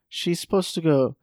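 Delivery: ordinary delivery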